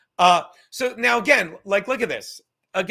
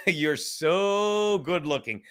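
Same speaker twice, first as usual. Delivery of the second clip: imitating Charlie; 3 semitones lower, 3 dB quieter, much slower than usual. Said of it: "He goes".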